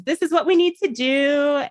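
high, insistent mock doctor; doctor talking over. Mother mimics doctor talking over her, high and insistent. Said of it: "she's talking over me".

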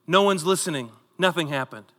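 usual speaking voice

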